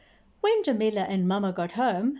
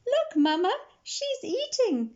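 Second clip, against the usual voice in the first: excited squeal. A narrator's reading. Jamila shows mama the chick eating, squealing. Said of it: "squealed Jamila".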